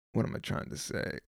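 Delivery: under-the-breath whisper, searching for words; a real whispered aside, half to himself; whispered to self. Real, not acted. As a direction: mutter it to yourself, lost in thought. Muttering to himself mid-podcast.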